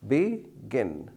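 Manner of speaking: "begin" broken into two separate syllables. Shown as a demonstration. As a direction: say "begin" as be, then gin, with a gap. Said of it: "two syllables".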